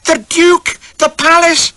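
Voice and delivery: very high, loud excited squeal. Acted. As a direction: squeal with delight. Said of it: "she squeals".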